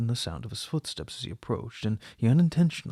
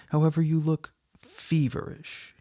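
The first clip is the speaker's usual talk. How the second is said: "feverish" leaned on, higher and louder; stress on feverish; 5 semitones higher, 5 dB louder than usual. A narrator's reading. The husband pointedly remarks to his wife, stress on "feverish". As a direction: land the weight on "feverish". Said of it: "emphasizing the last word".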